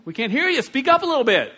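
calling out. Pretend. Calling out to a quiet person.

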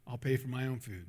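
low and subdued, final; quiet refusal. Retold or imitated. Customer setting money down for the cashier, quiet refusal. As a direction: say it softly, closing the matter.

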